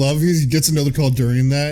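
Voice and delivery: normal talking voice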